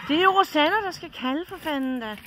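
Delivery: loud, high, fed-up yell; exasperated shout. Real, not acted. Wife yelling at Per that Rosanna should call, loud and high. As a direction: vent your frustration at full volume.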